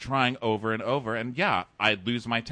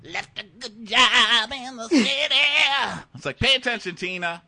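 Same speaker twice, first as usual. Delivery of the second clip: mock Ike; 12 semitones higher, 6 dB louder than usual. Quoting Ike's line to Tina as a hammy threat. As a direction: bark it like a movie villain.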